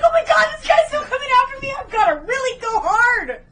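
high, loud, hammed-up panic; mock panic. Voicing a villain still being chased, mock-panicked shout. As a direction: shout it like a comically desperate runaway. Voices the chased man in retelling.

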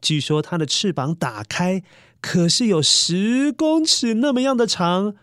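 usual speaking voice

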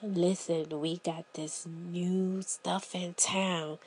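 low, soft, slow secretive pitch; a Girl Scout whispering like a dealer; hushed dealer whisper. Retold or imitated. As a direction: sell it in a conspiratorial hush. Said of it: "And they be like".

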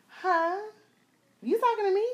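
hammed-up surprised comeback. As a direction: act offended, then curious. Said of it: "And I'm like".

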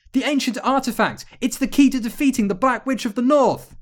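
high, loud excited cry; breathless-with-urgency storybook yell; urgent shout. A narrator's reading. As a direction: shout the key to victory. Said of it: "shouted Vivian".

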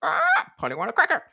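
high squawking parrot. Retold or imitated. Parrot speaks again.

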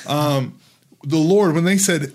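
ordinary talk, nothing marked

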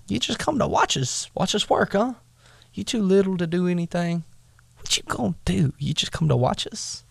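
mock him for only watching; taunting brother. Brother mocks David.

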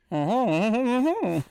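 mock French chuckle; French accent. Shown as a demonstration. Asking co-host for French, mock French. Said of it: "can you do a French".

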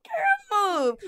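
very high squeaky mock protest; mock annoyed. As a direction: shoo the niece off, half joking. Pushing the niece away in bed.

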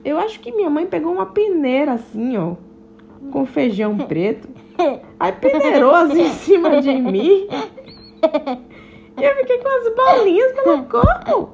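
lightly voiced little ladybug, high and giggly at times; a chatty little ladybug telling her tale; hint of a cheerful ladybug girl. Joana the ladybug explains herself.

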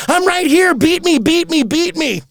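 high, eager little voice; eager Gilligan. Acted. Gilligan eagerly offering himself to the skipper, high and keen.

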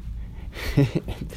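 soft, voiceless chuckle; short breath of laughter; amused chuckle. Real, not acted. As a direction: laugh quietly to yourself. Amused while telling own story.

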